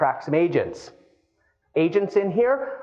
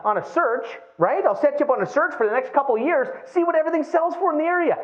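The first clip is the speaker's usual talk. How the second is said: eager helpful realtor; 8 semitones higher than usual. Retold or imitated. Acted eager realtor offering help.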